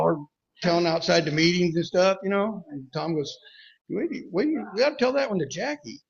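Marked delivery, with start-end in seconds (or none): 3.9-6.0 high-pitched, eager interruption; excited quote. Retold or imitated. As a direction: imitate a friend excitedly cutting in. Acting out Tom's eager interruption.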